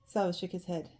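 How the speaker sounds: plain reading voice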